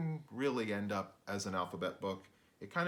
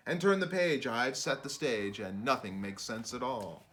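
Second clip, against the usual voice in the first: put-on lively sing-song read-aloud for kids; bouncy picture-book narration; rhyming storybook reading; 2 semitones higher, 4 dB louder than usual. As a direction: read it like a bedtime rhyme to children.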